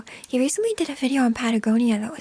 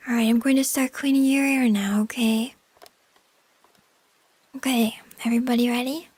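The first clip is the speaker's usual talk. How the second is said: right in your ear. Acted. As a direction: whisper gently, right by the ear. ASMR ear cleaning roleplay.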